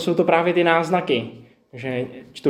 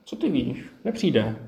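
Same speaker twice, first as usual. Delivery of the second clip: quiet inner voice; 6 dB quieter than usual. Retold or imitated. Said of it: "mě oslovuje a říká mi".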